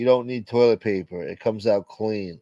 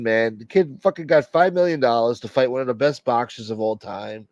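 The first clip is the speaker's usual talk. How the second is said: ordinary talk, nothing marked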